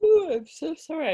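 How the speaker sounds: words stretched by a yawn. Real, not acted. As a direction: talk through a yawn. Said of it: "I keep yawning".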